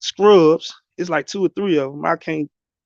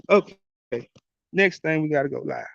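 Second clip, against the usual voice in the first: ordinary delivery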